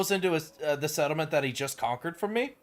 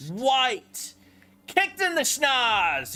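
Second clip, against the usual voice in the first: higher, slowed gloat as the enemy falls; gleeful gloating; 9 semitones higher, 4 dB louder, much slower than usual. Real, not acted. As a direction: crow over the beaten rival.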